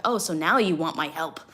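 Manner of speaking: loud mock sass at YouTube; loud sarcastic comeback; sassy retort. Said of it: "And I'm like".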